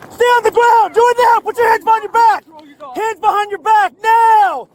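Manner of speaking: loud commands; firm yelled commands; shouting orders. Real, not acted. Deputy orders suspect down.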